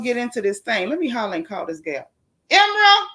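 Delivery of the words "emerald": mama scream across the house. Real, not acted. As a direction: holler their names like a mama. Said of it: "I can scream across the house".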